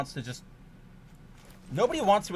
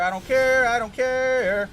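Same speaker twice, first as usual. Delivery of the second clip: higher, louder, the last one slow and drawn out; angry outburst; 8 semitones higher, 8 dB louder, slower than usual. Real, not acted. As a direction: blow up in real frustration. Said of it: "I'm mad".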